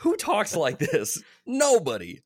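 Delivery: exasperated, leaning hard on every word; incredulous rant. Real, not acted. Co-host mocking the movie's weird lines to a friend, incredulous.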